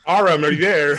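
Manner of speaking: calling out for Aram in jest; mock call. Player teasing the DM's accent by calling for Aram.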